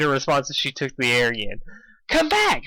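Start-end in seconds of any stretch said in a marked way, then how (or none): 2.1-2.6 shrill high call after her; high yell. Acted. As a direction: yell it high across the sky. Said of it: "Pinkie yelled".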